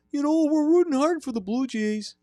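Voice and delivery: put-on high-pitched Wisconsin hockey accent; high, folksy Wisconsin creep accent; Canadian-sounding accent. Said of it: "it's coming off Canadian".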